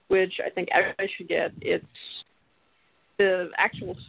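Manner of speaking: usual speaking voice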